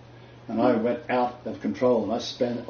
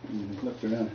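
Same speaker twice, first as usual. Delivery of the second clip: usual speaking voice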